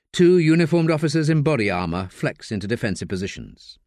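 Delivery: usual speaking voice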